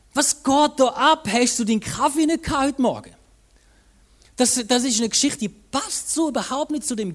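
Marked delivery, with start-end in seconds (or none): 0.1-3.1 mock exasperation. Preacher joking about a grumpy Jesus, loud mock exasperation. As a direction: tease him about missing his coffee. Preacher voices his own bewildered thought.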